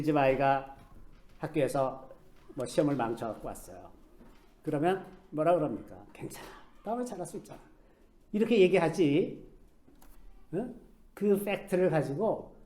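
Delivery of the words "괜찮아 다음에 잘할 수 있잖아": soft reassurance. Retold or imitated. Parent comforting child after test.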